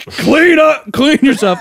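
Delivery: loud, high shouted order; hammed-up exasperated command; mock angry dad. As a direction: yell it like a fed-up father. Dad orders kid in shower.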